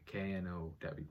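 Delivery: ordinary talk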